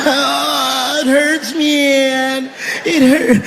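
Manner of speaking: lightly voiced whiny dying addict; mock dying addict. Dying addict moans last words to the shooter, mock whiny. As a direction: whimper it like a dopey dying junkie. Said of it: "stupid heroin last words".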